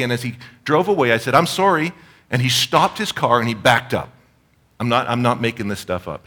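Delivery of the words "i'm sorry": high, loud call after a departing car; calling out sorry. Retold or imitated. Calling sorry after a driver pulling away, high and loud. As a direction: shout the apology after him, high and loud.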